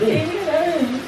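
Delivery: loud, hammed-up fake crying; pretend-upset husband shouting at wife to go away; mock upset. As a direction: push the partner away with a loud fake sob.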